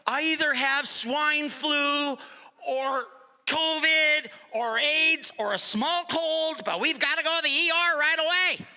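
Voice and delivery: slow, fussy mock-panic of a nervous wife; mock anxious wife. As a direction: drag it out like a hypochondriac. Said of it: "She's either like".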